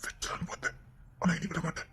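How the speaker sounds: whispered, barely voiced; husband whispering menacingly to the frightened woman. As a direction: breathe it as a threat.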